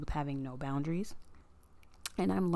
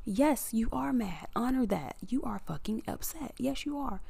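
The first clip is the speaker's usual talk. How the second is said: higher, insistent self-talk; emphatic self-validation; 6 semitones higher than usual. Acted. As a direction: firmly contradict your own denial, pitched up. Answers own denial in acted self-talk.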